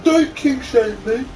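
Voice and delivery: high, slow mock whine of a defensive fan; mocking commenter. Mocking an imagined commenter, high whiny voice. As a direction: parody a touchy fan online.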